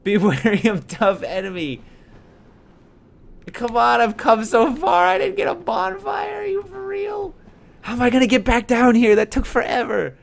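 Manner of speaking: high, loud frustrated yell. Real, not acted. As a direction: shout it out at the screen. Frustrated yelling at game.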